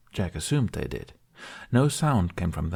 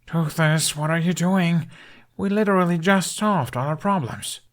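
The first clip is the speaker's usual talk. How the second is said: put-on high, louder exasperated young rider; exasperated complaint; 8 semitones higher, 3 dB louder than usual. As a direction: protest in disbelief. Hiccup flung onto fleeing dragon.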